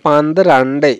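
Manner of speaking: পান্দ্রান্ডে, both n sounds clear; পান্দ্রান্ডে with an ndr cluster; correct Malayalam pronunciation. Shown as a demonstration. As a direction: model পান্দ্রান্ডে as pan-dran-dey. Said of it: "এরকম করে কিন্তু আমাদের মালায়ালাম উচ্চারণটা হবে".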